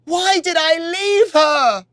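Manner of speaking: high, slow anguished yell; grief-stricken shout. Acted. Warrior blaming himself over her body, despairing. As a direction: cry out in despair. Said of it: "he cried".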